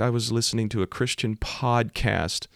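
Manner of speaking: high-pitched, mock-weepy quote; imitated tearful confession. Crying driver explaining the crash, imitated tearful voice. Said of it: "She's just crying".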